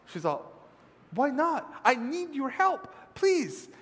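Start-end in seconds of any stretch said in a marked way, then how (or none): 1.1-3.7 high-pitched, insistent plea; exasperated mother. Acted. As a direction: press the child for help. Mother pleading with reluctant son.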